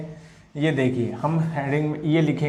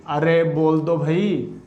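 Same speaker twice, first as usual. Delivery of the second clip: normal talking voice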